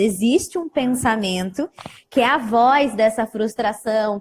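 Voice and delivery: ordinary delivery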